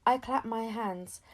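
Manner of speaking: usual speaking voice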